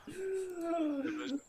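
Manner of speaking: very high, very slow squeak; squeaky drawn-out voice. Acted. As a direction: stretch it out in a tiny squeak.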